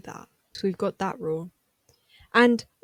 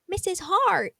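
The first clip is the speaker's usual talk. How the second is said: shocked quote; 10 semitones higher, slower than usual. Retold or imitated. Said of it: "Agatha goes".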